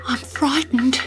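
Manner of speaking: high, small, scared; a girl's mild fear; frightened whisper. Acted. Dot tells the kangaroo she's scared, high and small. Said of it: "Dot whispered".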